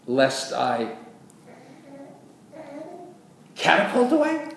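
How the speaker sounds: exaggerated disbelief, pitched very high; mock incredulous question. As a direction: launch it suddenly, voice leaping up. Mock misreading set against drift.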